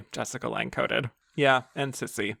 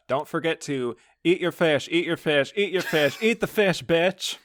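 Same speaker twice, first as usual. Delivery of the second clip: Violet Weston quote; 5 semitones higher than usual. Retold or imitated. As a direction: hammer it home, climbing each time. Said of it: "The ghost of Glenda ... comments".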